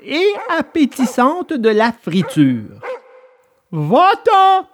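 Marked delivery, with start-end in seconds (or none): 3.7-4.7 high, louder threatening bark of a fisherman; menacing fisherman. A narrator's reading. Fisherman driving off the hungry dog, menacing shout. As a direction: chase the dog off with a menacing yell. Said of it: "lui cria menaçant le pêcheur".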